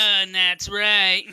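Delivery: Larry the Cable Guy. Acted. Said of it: "Larry the Cable Guy".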